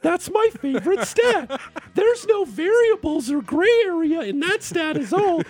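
high, sugary fake-enthusiastic gushing; fake lying voice. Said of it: "this is your line voice".

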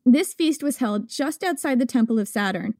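ordinary delivery

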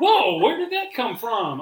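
loud, high startled exclamation; taken-aback "whoa"; shocked retort. Retold or imitated. Retold shocked reaction to child.

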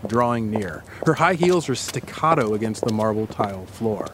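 lightly voiced guided-scene narration, calm and even; story narration. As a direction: paint the scene for her, unhurried. Narrates immersive scene to co-host.

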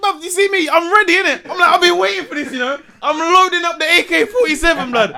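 loud and high, buzzing with excitement; fired up and ready for the game; hyped retelling. Retold or imitated. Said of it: "I was like".

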